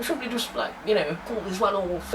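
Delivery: lightly voiced dithering public schoolboy; a hint of a nervous posh chap; mock posh English chap. Mocking the novel's dialogue, mock posh chap. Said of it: "As if someone from Wolverhampton's gonna say".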